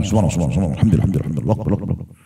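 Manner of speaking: hurried gabble, low and fading away; rushed mumbling. Shown as a demonstration. Preacher shows worshippers racing through dhikr, rushed mumble. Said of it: "Buru-buru".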